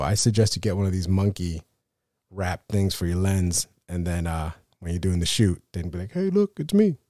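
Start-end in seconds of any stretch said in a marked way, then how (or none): 6.1-7.0 high goofy hello; goofy high-pitched camera greeting; silly high voice. Pretend. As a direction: squeak it out like a cartoon. Mock greeting for camera monkey.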